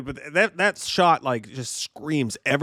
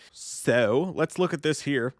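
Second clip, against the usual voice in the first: usual speaking voice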